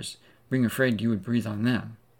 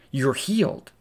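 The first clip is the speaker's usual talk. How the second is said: joyful declaration; 3 semitones higher, 3 dB louder than usual. Acted. Preacher proclaiming the woman's healing, excited. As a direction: announce the healing with rising joy. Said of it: "and you're healed".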